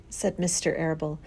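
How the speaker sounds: usual speaking voice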